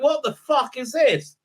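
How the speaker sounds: a slowed, disbelieving what the fuck; baffled quote. Retold or imitated. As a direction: drag it out in puzzled disbelief. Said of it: "Every time I look at that, I go".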